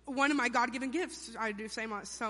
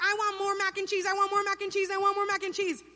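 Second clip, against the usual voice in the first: high, louder whining chant; whiny demanding kid; 8 semitones higher, 4 dB louder than usual. Acted. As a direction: nag on and on like a spoiled child. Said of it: "my cousins are such whiners".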